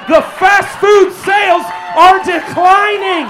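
shout. Acted. Ranting comedian yells news.

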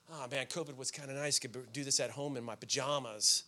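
soft, low, lazy sigh of comfort; a churchgoer tempted to stay home murmuring contentedly; lazy, complacent musing. Retold or imitated. Quoting lazy churchgoer thought.

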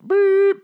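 a long high beep like an alarm; high drawn-out beep noise. Retold or imitated. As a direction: mimic the emergency alert tone. Imitating the alert beep.

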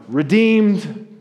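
high, loud triumphant-style proclamation; crying out. Acted. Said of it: "Jesus' blood cries out".

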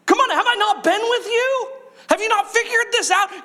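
high, pleading come-on-guys exasperation; Jesus scolding disciples, high and exasperated. Retold or imitated. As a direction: play Jesus fed up with his disciples. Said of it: "And he's gonna say".

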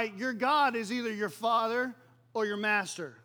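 normal talking voice

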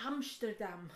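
put-on Dutch accent. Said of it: "teach you the Dutch version".

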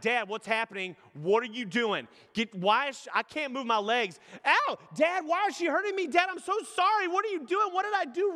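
put-on high, pleading cries of a scared boy; panicked child. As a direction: plead with Dad like a frightened four-year-old. Son crying out during shots.

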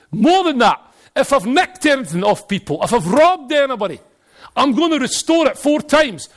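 high, loud impassioned declaration; fervent vow. Acted. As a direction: proclaim it, building to a high peak. Zacchaeus proclaims his repentance to Jesus.

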